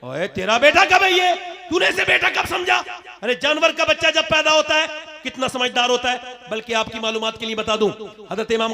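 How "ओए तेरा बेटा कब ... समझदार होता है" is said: high, raised-voice challenge; loud, high preacher's scolding; scolding rebuke. Acted. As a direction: call out the parent sharply. Preacher rebukes the wailing father.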